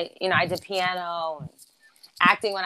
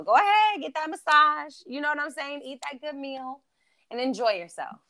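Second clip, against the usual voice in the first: excited hype; 7 semitones higher than usual. Real, not acted. Hyping up self-care treats.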